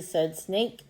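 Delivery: slow, deliberate story reading; children's story read-aloud. Acted. Teacher reading a crow story to pupils, slow storytelling. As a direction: read slowly and clearly for young pupils.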